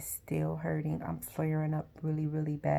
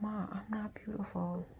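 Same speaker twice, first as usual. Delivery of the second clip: small, higher, hushed retelling; softly protest, breath in the voice; hurt self-doubt; 4 semitones higher, 6 dB quieter than usual. Retold or imitated. Crying daughter answering her mother's compliment, hurt self-doubt.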